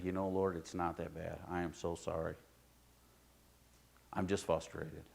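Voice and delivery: soft, low-voiced apology in prayer; hushed confession to God. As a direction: kneel and confess quietly, sorry and spent. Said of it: "And I say".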